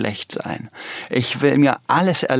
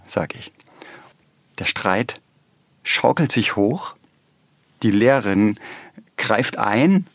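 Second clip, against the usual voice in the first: ordinary talk, nothing marked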